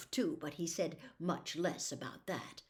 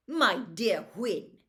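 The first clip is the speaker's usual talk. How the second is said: louder, slow and condescending; scornful; 2 semitones higher, 8 dB louder, slower than usual. Acted. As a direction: look down on her idea. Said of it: "rather scornfully".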